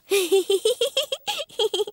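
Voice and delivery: bright high giggle. Acted. Mummy Pig giggling at Peppa's game, high giggle. Laugh at pretend.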